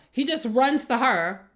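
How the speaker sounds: normal talking voice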